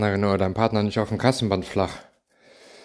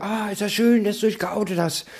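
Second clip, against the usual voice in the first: mock gushing; 9 semitones higher than usual. Quoting gushing group posts.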